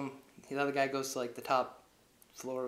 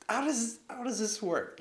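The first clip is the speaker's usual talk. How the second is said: incredulous; 10 semitones higher, 4 dB louder, slower than usual. Real, not acted. Incredulous aside about plot.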